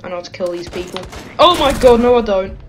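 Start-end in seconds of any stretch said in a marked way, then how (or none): 1.4-2.6 loud startled yelp; panicked outburst. Real, not acted. Player realizes he can't win the fight, loud panicked yelp. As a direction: blurt it loud and higher in panic.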